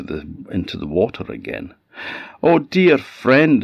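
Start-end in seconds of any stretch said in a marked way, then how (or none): 2.4-3.6 high, slow, louder cry of a stranded fish; the stranded salmon begging the ash lad for help. Acted. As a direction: call out desperately from the bank. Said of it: "it cried out to the ash lad".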